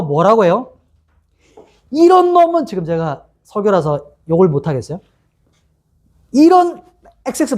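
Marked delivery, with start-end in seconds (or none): 1.9-2.7 high-pitched imitation of jeering; mock angry shout. Mob follows Paul on the stretcher cursing him, mock shouting. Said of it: "막 계속 소리 지르는 거야". 6.3-7.6 high, breaking off before the curse; mock angry shout. Mob hurls curses at wounded Paul, mock shouting. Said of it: "막 계속 소리 지르는 거야".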